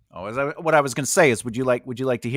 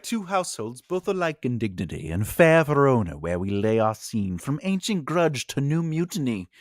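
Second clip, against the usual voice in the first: put-on theatrical old English verse delivery; mock Old English accent. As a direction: recite in a badly faked old English accent. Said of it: "Old English with".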